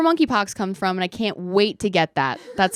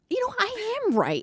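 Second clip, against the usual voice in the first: high, sing-song "I am right"; playful self-satisfied reply; smug self-agreement. Retold or imitated. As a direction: preen in a mock-pleased voice. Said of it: "And I was like".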